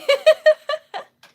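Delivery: high, loud laugh; burst of laughter. Real, not acted. Streamer greeting viewers, loud giggling burst. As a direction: laugh hard right after the greeting.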